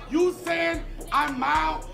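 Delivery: ordinary talk, nothing marked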